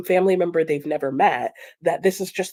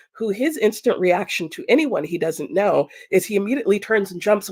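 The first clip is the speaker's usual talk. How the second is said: ordinary talk, nothing marked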